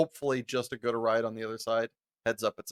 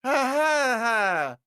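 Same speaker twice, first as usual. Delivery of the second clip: high, forced ha-ha-ha; fake laugh; 9 semitones higher, 3 dB louder, much slower than usual. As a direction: laugh politely at an unwanted joke. Forced laugh at unwanted joke.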